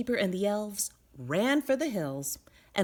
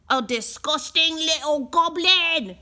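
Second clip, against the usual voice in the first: loud, higher sneer of disgust; disgusted innkeeper; 7 semitones higher, 9 dB louder than usual. A narrator's reading. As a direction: spit it out in revulsion.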